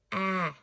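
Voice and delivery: "ah" as an isolated short A, as in cat; short A sound. Shown as a demonstration. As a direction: say ah alone as the letter's short sound. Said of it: "it's a short sound".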